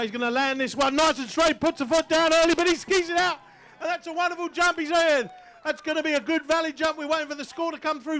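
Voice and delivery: rising thrill of the landing call; excited call. Real, not acted. Announcer calling the skier's jump to the crowd, excited.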